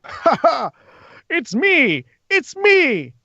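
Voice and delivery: high put-on monster voice; gleeful creature. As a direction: cackle and crow in a high voice. Game master voices the morphing creature.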